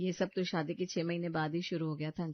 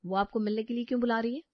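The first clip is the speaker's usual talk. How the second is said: rushed demand; 3 semitones higher, faster than usual. Acted. Wife demands explanation.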